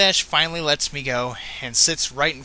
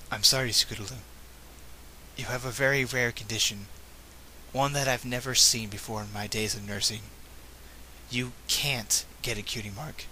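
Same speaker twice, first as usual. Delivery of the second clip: a hint of a gentle nurse, lower and softer; lightly voiced nurse; 3 semitones lower, 7 dB quieter than usual. Nurse telling Scootaloo she can't get a cutie mark, softly. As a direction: break the news softly as the nurse. Nurse delivers bad news.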